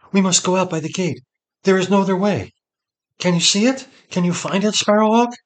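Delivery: high, loud, desperate urging; frightened woman hurrying her companion; urgent alarm. A narrator's reading. Seret desperate to escape by gate.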